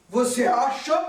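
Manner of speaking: spirit's vain voice. Retold or imitated. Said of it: "Aquela voz".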